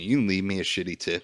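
ordinary talk

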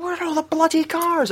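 high hammed-up Scottish cry; mock Scottish accent. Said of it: "I was like".

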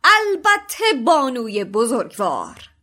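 loud, slow, grand reply; proud knight's cry. A narrator's reading. The knight answering the professor grandly, loud and slow. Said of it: "سرکدگن فریاد زد".

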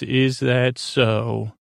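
slow menacing drawl; slow drawn-out question. Retold or imitated. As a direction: stretch it out slowly, each word weighed. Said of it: "He says".

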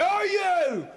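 high mock Cockney holler; put-on Cockney greeting cry; Cockney accent. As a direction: burst in yelling like a Cockney. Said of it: "I come in and I say".

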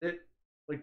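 ordinary talk, nothing marked

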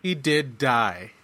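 "did" drawn out slowly and firmly; stress on DID. Real, not acted. Host rebutting "he didn't actually die", slow insistent stress on "did". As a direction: lean slowly on "did" to contradict.

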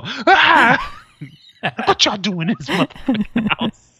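high, bursting in with a hammed-up shout; mock angry dad. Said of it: "break it and go".

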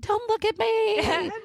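very high, slow drawn-out squeal; mock embarrassed wail. Embarrassed gym-goer to her admirers, high drawn-out mock wail. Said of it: "I was so embarrassed".

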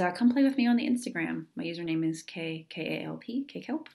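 normal talking voice

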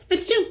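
small high-pitched sneeze; a tiny squeaky achoo. Retold or imitated. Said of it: "it would be like".

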